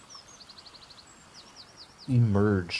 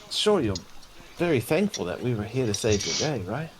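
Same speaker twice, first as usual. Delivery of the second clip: relieved rescued woman; 4 semitones higher, faster than usual. Acted. Plays Adina thanking her rescuers.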